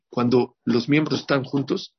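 ordinary delivery

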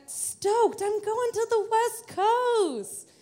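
high, drawn-out excited crow; stoked. Retold or imitated. Telling the crowd she booked her plane ticket, stoked. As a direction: stretch it out, high-pitched and thrilled. Elated at booking the trip.

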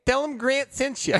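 high, hammed-up Chicago accent; mock Chicago local giving a tip. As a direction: lay the Chicago accent on thick. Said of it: "I'm gonna do a Chicago accent".

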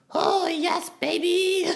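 very high, breathy-style mock seductive purr; sultry cat voice. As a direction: play the cat purring like a lover. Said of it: "she learned how to go".

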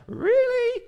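high, loud, drawn-out "really?"; incredulous mock question. Preacher voicing the sailors' reply to Jonah, mock incredulous. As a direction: imitate sailors balking in loud, squeaky disbelief.

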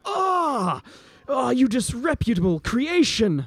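high-pitched, pained outburst; cry of pain. Acted. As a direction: cry out high, then curse through the pain. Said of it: "He cries out in pain".

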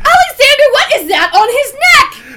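high, loud horrified outburst; shocked. Acted. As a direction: gasp it out in horror. Housekeeper shocked at the prank.

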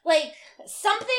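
fake-rage at someone's good skin; mock rant. Mock rant at brother's perfect skin.

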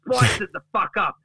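high-pitched, worked-up mock rant; indignant Duck. Duck bursts through the door at Gary, indignant rant. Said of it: "Duck opens the door and says".

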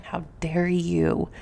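usual speaking voice

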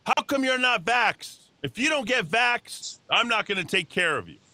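scolding doctor, high-pitched and drawn out; imitated yelling doctor. Doctor berating an unvaccinated old patient, imitated yelling. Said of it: "My doctor started yelling at me".

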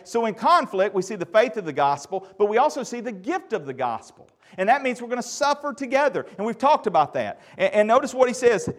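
loud, high, driving sermon voice; fervent loud preaching to the church; full-force preaching. Real, not acted. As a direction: preach it out to the congregation.

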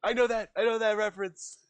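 high-pitched imitation of an eager know-it-all; mock eager reference-spotter. Player imitates someone recognizing a reference, in high mock eagerness.

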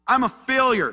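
high, loud cry of hopelessness; Elijah's despair. Acted. Elijah tells God he has failed, loud despair. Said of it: "He says".

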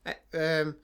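normal talking voice

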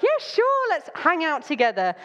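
high, bright eager "yeah, sure"; mock eager yes. She plays an overkeen friend greeting a man holding a head, eager. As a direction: gush like an overkeen new friend.

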